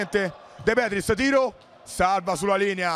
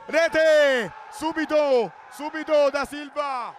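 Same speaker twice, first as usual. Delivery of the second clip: high excited goal call; breathless italian goal commentary; 7 semitones higher, much slower than usual. Real, not acted. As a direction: shout the goal, pitch up. Goal called, then scorer.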